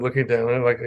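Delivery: ordinary talk, nothing marked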